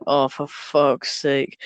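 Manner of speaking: low, slow, weary retold groan; understated exasperated aside; retold exasperation. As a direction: drag it out, mildly fed up. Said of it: "I was like".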